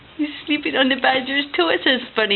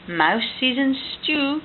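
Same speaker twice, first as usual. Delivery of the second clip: ordinary delivery, nothing marked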